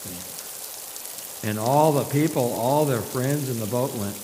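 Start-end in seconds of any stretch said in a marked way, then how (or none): none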